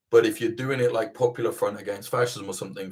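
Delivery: ordinary talk, nothing marked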